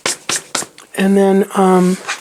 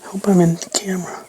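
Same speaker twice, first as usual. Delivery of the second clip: whispering; 2 semitones lower, 6 dB quieter than usual. Real, not acted. Muttering to herself while crafting.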